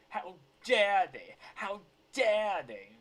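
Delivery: loud, high, drawn-out indignation; mock outrage. Commentator mimicking a video's outraged cry, hammed-up indignation. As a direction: blast it out, stretching DARE. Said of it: "I'm gonna say it like that humongous video".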